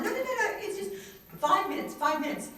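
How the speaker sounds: very high, loud rush of protest; squeaky, pleading insistence; insistent reassurance. Retold or imitated. Sister talks her reluctant brother round, high insistent reassurance.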